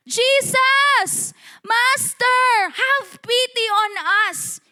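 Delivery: high, loud cry from afar; desperate shout. Acted. Ten lepers calling to Jesus from afar, shouted pleading. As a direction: call out at full voice, pleading for pity. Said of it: "Hindi po yung natural lang na sigaw".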